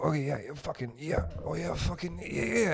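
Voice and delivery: imitating a fast-talking friend. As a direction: rattle off the yeahs like an eager pal in your face. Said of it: "fast talker".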